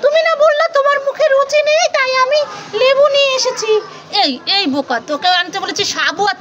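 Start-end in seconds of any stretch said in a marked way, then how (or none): 0.0-3.7 put-on higher-pitched pouty kid voice; sulky child character. Mother voicing a toy child who sulks off, higher pouty voice. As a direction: play the child toy stomping off in a huff.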